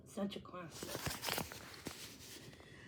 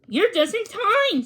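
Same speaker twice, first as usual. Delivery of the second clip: jolly host; 8 semitones higher, 4 dB louder than usual. A narrator's reading.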